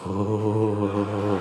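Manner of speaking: low sung ghostly ooh; a comic low ghost ooh; mock ghost moan. Comic imitating a Lowell ghost, low moaning ooh. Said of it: "It was like".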